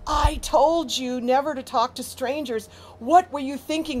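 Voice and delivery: high, loud scolding dad; furious Italian father scolding; angry father. Retold or imitated. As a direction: tear into her like an angry dad.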